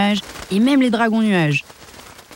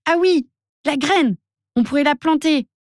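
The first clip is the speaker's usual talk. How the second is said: much higher, bouncing delight; joyful; 9 semitones higher than usual. A narrator's reading. Adélie Dello finds the seed, high joyful cry. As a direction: burst with a happy idea. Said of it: "Elle bondit de joie".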